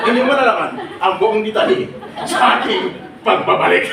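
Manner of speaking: teaser announcer. Shown as a demonstration. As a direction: tease like a TV host before a break. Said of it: "Ang lagi kong sagot, ganito". (parang host na nambibitin)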